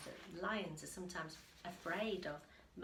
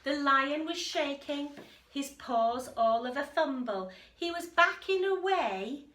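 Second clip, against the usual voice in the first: louder rhyming storybook reading; lively story narration; 6 semitones higher, 10 dB louder than usual. A narrator's reading. Reading the frightened lion to a child, lively rhyme. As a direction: bounce the rhyme along. Reads picture book aloud.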